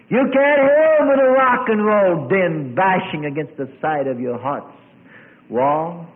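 high, loud sermon cry; impassioned preacher's holler; preaching shout. Real, not acted. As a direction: thunder it at the congregation. Preacher shouts against worldly noise.

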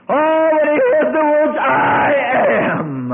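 loud, high shout; preaching shout. Real, not acted. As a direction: shout it out to the congregation. Preacher at full force.

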